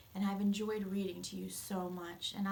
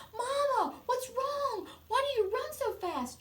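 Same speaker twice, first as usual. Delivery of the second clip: much higher, louder, urgent calling; worried boy calling; 6 dB louder than usual. Acted. George calling to his mother running up, high and urgent. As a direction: call out to her like a startled boy.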